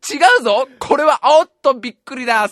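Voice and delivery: mock play-by-play.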